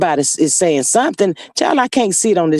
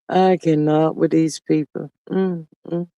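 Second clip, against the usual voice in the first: ordinary delivery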